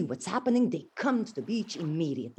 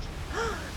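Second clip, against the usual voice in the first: sharp high gasp; 11 semitones higher, 5 dB quieter than usual. Acted. Narrator reacting to the sinking ship, gasping. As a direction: gasp in alarm.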